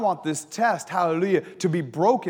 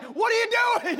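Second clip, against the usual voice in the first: mock scream at son. Father in the car with his stuck son, mock exasperated yell. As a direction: pretend to blow up at the kid, pitched high. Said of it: "I'm getting ready to scream at him like".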